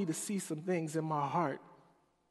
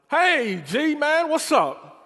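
big, much higher, louder hail; loud greeting; 13 semitones higher, 13 dB louder, slower than usual. Retold or imitated. Loud friend George greets him, booming high call. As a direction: boom it out like a giant. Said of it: "George was tall, loud".

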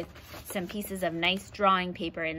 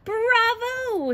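much higher, louder delighted bravo; proud bravo; 13 semitones higher, 6 dB louder than usual. Acted. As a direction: cheer the student on. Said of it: "said the lion".

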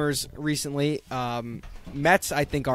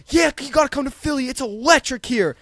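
higher, louder hype-man voice; hyped Bryce Harper impression; 9 semitones higher, 5 dB louder than usual. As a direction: sell Philly like an excited star. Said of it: "Bryce Harper's like".

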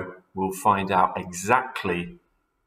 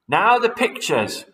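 high, loud command; cried out; 11 semitones higher, 6 dB louder than usual. Acted. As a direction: call the next job out to the birds. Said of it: "cried Mugglewump".

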